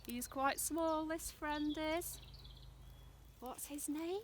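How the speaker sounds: ordinary talk, nothing marked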